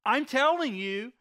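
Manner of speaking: mildly exasperated insistence, pitch lifted; a touch of impatience, voiced for a character; acted exasperation. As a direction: insist as if tired of being asked. Healed man, questioned again, insists.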